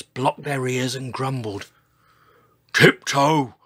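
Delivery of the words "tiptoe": loud bellowed order; bellowing. A narrator's reading. Old Triceratops orders the giant, bellowing. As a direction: bellow it as a command. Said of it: "George would bellow".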